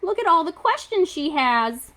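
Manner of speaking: usual speaking voice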